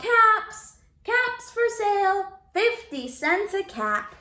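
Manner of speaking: high, loud street cry; calling out wares. Acted. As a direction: sing out caps for sale like a peddler. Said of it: "he called".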